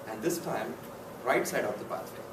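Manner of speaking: usual speaking voice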